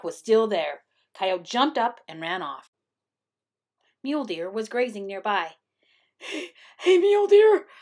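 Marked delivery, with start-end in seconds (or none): 6.2-7.7 high, loud call for attention; desperate cry. A narrator's reading. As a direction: call out urgently as panicked Coyote. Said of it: "cried Coyote".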